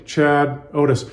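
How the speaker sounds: plain reading voice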